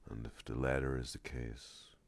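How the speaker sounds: very soft, low ASMR murmur with long pauses. Real, not acted. Streamer musing to viewers about ASMR, hushed ASMR voice. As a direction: speak very quietly and unhurriedly, as if close to the mic. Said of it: "I'm already doing it right now".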